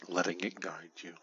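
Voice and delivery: ordinary talk, nothing marked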